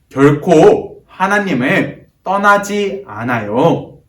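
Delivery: loud, slow, high-pitched slogan shout; loud group call-out. Shown as a demonstration. Preacher leads children in calling out the key message, loud and slow. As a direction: call it out slowly, word by word, for children to join. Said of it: "큰 목소리로 외쳐볼까요".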